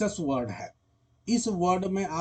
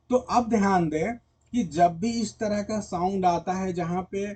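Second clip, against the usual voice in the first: usual speaking voice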